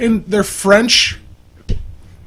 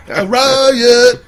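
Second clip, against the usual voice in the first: laughing echo; 6 semitones higher, 4 dB louder than usual. Pretend. Echoing the riot call laughing.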